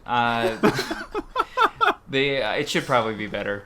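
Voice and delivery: usual speaking voice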